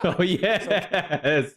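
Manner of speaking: high, laughing "oh yes"; laughing delight. Real, not acted. Streamer enjoying the intro line impression, laughing. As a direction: laugh through the words in approval.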